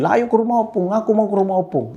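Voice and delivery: put-on high, insistent child's demand; mock child yelling. Son demanding to go to his grandparent's house, mock yelling. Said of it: "dia teriak-teriak di mobil".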